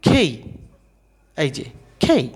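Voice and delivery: খেই with a puff of air after k; aspirated K. Shown as a demonstration. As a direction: say খেই as breathy "khay" with a glide. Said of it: "কে এর উচ্চারণটা হচ্ছে".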